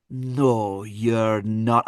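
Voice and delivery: disbelieving Scottish mum; Missus Butler. Retold or imitated. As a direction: contradict him in a drawn-out Scottish accent. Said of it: "tiny little Missus Doubtfire, basically".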